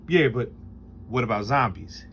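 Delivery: mimicking a humorless friend in a low deadpan; imitating flat Rob. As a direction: ask it like a guy with zero emotion. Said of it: "That's how he said it".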